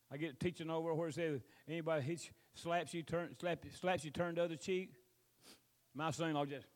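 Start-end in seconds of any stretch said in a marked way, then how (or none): none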